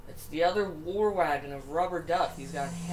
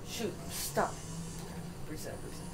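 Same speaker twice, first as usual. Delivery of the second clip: whispered frustration; 7 dB quieter than usual. Real, not acted. Stuck car, muttering.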